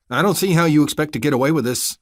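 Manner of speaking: loud, pointed challenge; said loudly. A narrator's reading. Narrator confronting the deputy, loud and defiant. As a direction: raise the voice to stall the deputy. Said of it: "I said loudly".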